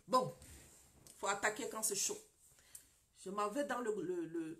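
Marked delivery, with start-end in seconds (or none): none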